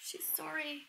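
barely whispered, moved; real hushed emotion; choked up. Real, not acted. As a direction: breathe it out, close to tears. Moved, near tears at video.

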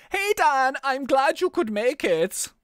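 put-on cheerful German-accented officer. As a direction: bark the cop's lines in a hammed-up German accent. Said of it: "he's picked up that German accent".

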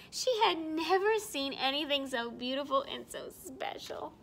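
ordinary delivery, nothing marked

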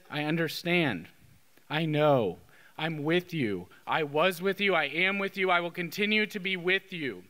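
low, consoling voice; gentle reassurance. Retold or imitated. Jesus to those in low moments, gently reassuring. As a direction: reassure them tenderly, pitched low.

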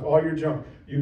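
normal talking voice

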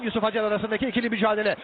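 ordinary talk

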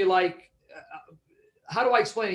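ordinary talk

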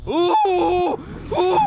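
sudden loud yelp of surprise; startled yelp. Real, not acted. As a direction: jump and yelp. Startled by game event.

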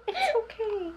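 gently calm the little one. Real, not acted. Mom soothing her upset toddler, soft high coo.

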